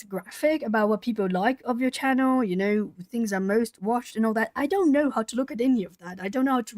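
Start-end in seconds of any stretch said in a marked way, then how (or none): none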